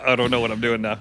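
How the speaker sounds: usual speaking voice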